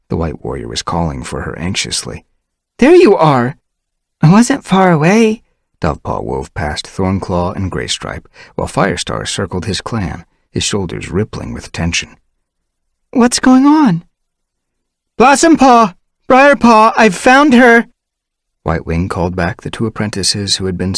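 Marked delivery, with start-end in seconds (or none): 2.8-3.6 high, loud relieved cry. Acted. Whitewing relieved. 4.2-5.4 high, louder protest; defensive young she-cat. Acted. Dovepaw defending herself. 13.1-14.0 high, loud worried question; anxious question. Acted. Dovepaw asking anxiously. 15.2-17.9 high, loud calls across the clearing; Whitewing calling back the apprentices. Acted.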